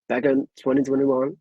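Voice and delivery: ordinary talk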